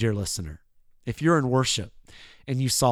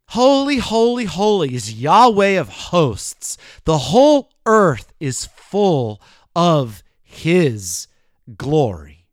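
slow, louder, drawn-out cry; angelic proclamation; 4 dB louder, slower than usual. Retold or imitated. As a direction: proclaim it like angels before the throne. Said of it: "They're crying out with their vocal abilities".

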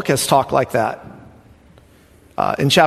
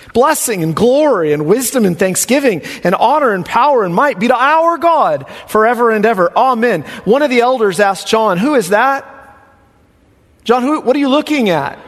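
exultant worship; 6 semitones higher than usual. Acted. Preacher voices the heavenly worshippers, high and exultant. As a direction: lift it up like a heavenly chorus.

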